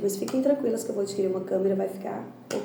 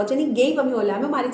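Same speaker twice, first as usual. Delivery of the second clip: usual speaking voice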